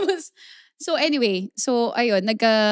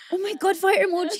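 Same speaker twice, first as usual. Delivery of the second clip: mock excited reaction; 7 semitones higher, 3 dB quieter, faster than usual. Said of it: "nagiging reaction namin babae".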